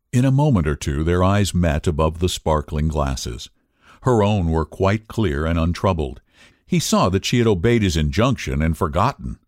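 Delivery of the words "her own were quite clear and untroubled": ordinary delivery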